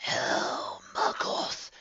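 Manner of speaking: slow, rasping whisper, disappointed; a looming shadow letting out its disappointment; disappointed rasping entity; sighing dark voice. A narrator's reading. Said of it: "the voice sighed".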